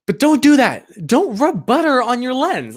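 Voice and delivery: still-worked-up plea; heated warning. Real, not acted. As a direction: warn viewers off firmly. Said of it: "I get heated about that".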